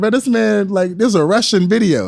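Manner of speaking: high, incredulous protest; disbelief. Retold or imitated. Doubting the coach claim.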